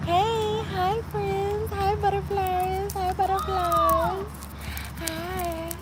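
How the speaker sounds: high, slow sing-song hello; high sweet greeting. Pretend. Mock ASMR greeting.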